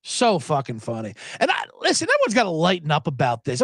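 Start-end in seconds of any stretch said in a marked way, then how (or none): none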